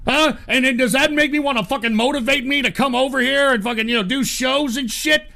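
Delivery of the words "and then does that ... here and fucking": ordinary talk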